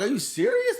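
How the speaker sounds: high-pitched mock disbelief; incredulous. Speaker retelling his reply to people missing his words, incredulous and high. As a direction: ask it jumping high in disbelief. Retold disbelief at not being understood.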